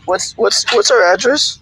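ordinary talk

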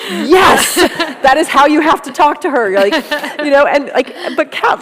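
laughing hard through the words; barely getting it out for laughter. Real, not acted. Telling a friend how everyone reacted, laughing loudly.

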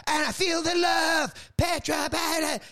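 high, slow, belted rock-star call; mock frontman yelling at the crowd; rock singer yell.

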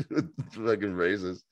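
ordinary talk

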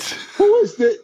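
high baffled question; surprised. Retold or imitated. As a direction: shoot it out in disbelief. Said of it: "I'm like".

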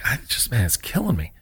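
a pained, soft admission; hurt, quiet; words dragged out by pain. Retold or imitated. Telling coach about the pain.

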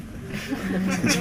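soft high laugh; amused chuckle at the skit. Real, not acted. Laughing after acting out the couple.

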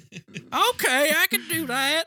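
put-on high, eager young actress voice; naive actress. Mocking the actress, who agrees in a high eager voice. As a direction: chirp it high like a clueless starlet.

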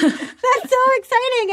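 high squeal of excitement; bright excited repeat. Real, not acted. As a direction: gush over the news. Echoing with excitement.

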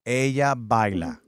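very slow, careful Ella baila; slow careful repeat. Real, not acted. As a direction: sound out each word like a beginner. Student repeating Spanish slowly.

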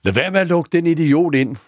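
high-pitched call across the room; mock yell, pitched well up; retold shout. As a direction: call it out high, imitating an annoyed regular yelling. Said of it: "der råber".

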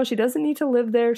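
plain reading voice

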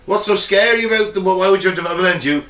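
ordinary delivery

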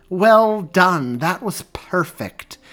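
loud, high, effusive congratulations; big delighted exclamation; exclaimed praise. A narrator's reading. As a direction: call out drawn-out applause to the performers. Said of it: "she exclaimed".

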